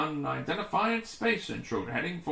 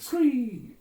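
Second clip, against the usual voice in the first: robot screech; 9 semitones higher, 6 dB louder than usual. Acted. Robot creature cry again.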